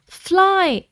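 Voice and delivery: begging. A narrator's reading. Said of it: "begged Jake".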